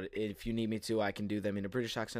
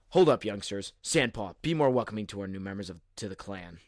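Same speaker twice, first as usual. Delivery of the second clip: calling out; 5 dB louder than usual. Acted.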